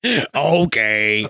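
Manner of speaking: low, drawn-out cowboy voice; Arthur Morgan. Acted. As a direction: drop it low and stretch it. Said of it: "Arthur Morgan".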